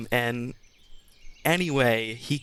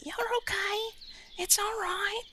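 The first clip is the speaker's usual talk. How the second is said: very high, soft whispered comfort; high soothing whisper; 5 dB quieter than usual. Acted. Chimney comforts his weeping lordship in a high whisper. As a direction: hush him like a crying child. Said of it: "And he goes".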